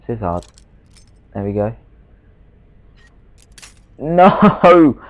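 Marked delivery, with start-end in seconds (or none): 4.0-5.0 high, loud frustrated no; frustrated yell. Real, not acted. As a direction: cry out in dismay. Lockpick breaks again.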